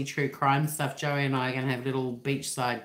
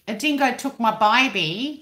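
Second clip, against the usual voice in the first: higher, louder mock-Cockney film impersonation; almost-Cockney accent; 7 semitones higher, 6 dB louder than usual. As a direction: put on a hammy Cockney twang, "baby" as "byebee". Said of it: "she sounds almost Cockney".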